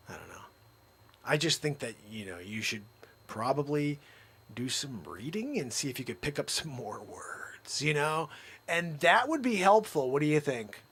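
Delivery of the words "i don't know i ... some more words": low soft near-whisper; quiet confidential advice to Zabrosk; hushed aside. Real, not acted. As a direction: lean in and say it privately.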